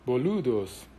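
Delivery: ordinary talk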